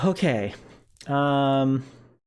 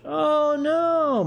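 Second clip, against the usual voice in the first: high yelp of dismay; surprised. Real, not acted. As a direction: cry out oh no. Reacting to chat.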